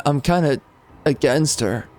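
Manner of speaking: ordinary talk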